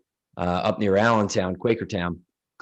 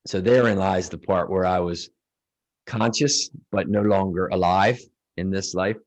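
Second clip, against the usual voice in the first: usual speaking voice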